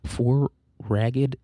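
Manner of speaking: usual speaking voice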